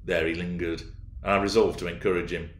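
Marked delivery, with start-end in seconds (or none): none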